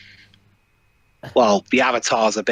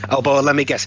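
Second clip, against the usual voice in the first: usual speaking voice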